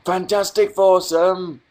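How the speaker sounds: high, loud, slow "Fantastic Foursome!"; excited announcement. Retold or imitated. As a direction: belt it out like a show title. Reading chat, excited.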